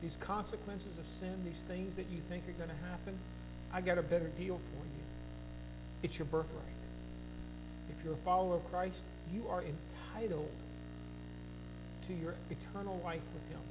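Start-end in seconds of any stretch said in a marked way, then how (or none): none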